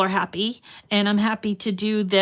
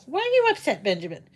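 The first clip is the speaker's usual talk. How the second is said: talking to the dog; 3 semitones higher, much faster than usual. Real, not acted. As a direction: coo a worried question at the dog. Talking to her corgi Benjamin.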